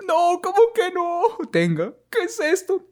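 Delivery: agudo y lento, sin creerlo; familiar incrédulo. Pretend. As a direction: reacciona con asombro dolido. Familiar incrédulo ante la noticia. (familiar incrédulo ante el doctor)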